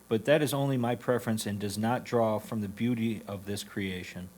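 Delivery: normal talking voice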